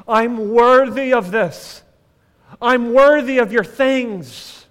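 loud, slow self-righteous claim; acted entitled older brother, drawn out; entitled protest. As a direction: insist slowly that you deserve it. Voicing the entitled older son.

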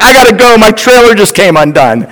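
hurried. Retold or imitated. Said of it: "I have to hang up the phone".

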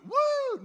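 high loud cheering whoop; whoop of excitement. Pretend. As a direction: let out a joyful whoop. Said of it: "Nobody got fired up about that and went".

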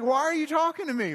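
loud, high-pitched disbelief; exasperated retort. Retold or imitated. Pastor repeats it to vacationing colleague, exasperated. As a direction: demand it in mock outrage, pitch way up.

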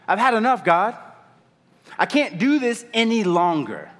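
high, exasperated plea to God; fed-up outcry. Acted. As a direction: cry out at the end of your rope. Voicing a believer fed up with God.

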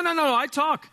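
usual speaking voice

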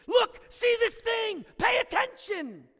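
high, loud urgent shout; emphatic shout. Shown as a demonstration. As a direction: yell to grab everyone's attention. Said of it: "it's an emphatic word".

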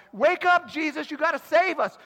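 high, loud, frantic plea; frightened disciples calling out over the storm; panicked disciples. Retold or imitated. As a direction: yell to wake him as the boat sinks. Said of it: "they are all freaking out".

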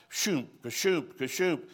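slow, pulsing "shoomp" mouth sound; heartbeat noise. Retold or imitated. Describing the boy's nosebleed, an imitated heartbeat thump.

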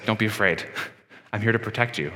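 low, soft reassuring bear voice; calm the frightened child, low and soft; gentle bear. Pretend. Bear in the house comforting a scared child, soft and low. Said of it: "the bear starts talking and says".

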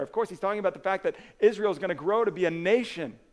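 full-force preaching. Acted. Pastor proclaiming Israel's kings to the congregation, loud preaching. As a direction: preach it out with conviction.

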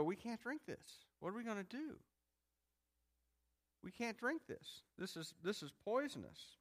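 high, hushed imitation of distress; small, high-pitched complaining voice; mock dismay. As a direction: act out helpless alarm, softly. Acting out Israelites tasting bitter water.

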